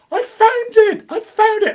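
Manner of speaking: high, loud triumphant cry; excited shout. Retold or imitated. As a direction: shout it like a great discovery. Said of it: "she shouted".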